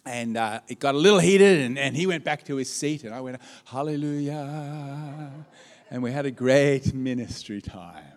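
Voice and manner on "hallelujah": mock relief. Pastor sighs relief after quarrel.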